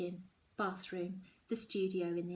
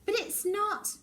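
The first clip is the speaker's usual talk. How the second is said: protest; 12 semitones higher, 5 dB louder than usual. Acted. Daughter protests.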